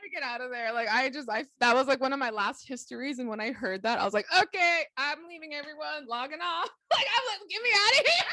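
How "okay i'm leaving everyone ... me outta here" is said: high, rising mock desperation; high-pitched hammed-up getaway; exasperated exit. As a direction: act out fleeing in comic despair. Retold fleeing the office in exasperation.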